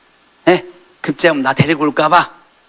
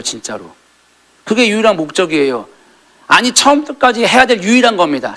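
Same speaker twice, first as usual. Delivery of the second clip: urgent plea; 8 semitones higher, 5 dB louder than usual. Acted. Preacher's urgent plea quoted.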